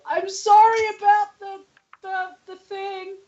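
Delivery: high, tearful apology; close to tears. Acted. Gav calling an apology from upstairs, close to tears. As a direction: apologize haltingly, on the verge of crying. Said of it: "He sounds like he's, like, close to tears".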